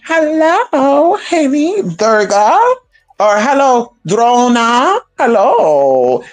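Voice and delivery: high, sing-song mock flirting hello; mock seductive flirt. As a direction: flirt sweetly with her husband to spite her. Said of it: "talk all seductive stuff".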